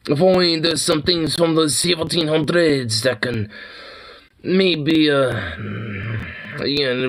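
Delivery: lightly hammed-up French accent; playful mock French; fake French accent. Mock French aristocrat bit.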